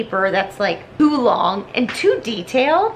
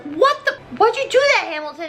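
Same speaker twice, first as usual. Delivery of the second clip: high indignant yell; 8 semitones higher, faster than usual. Retold or imitated. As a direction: squeal in outrage at him. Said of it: "Everybody's like".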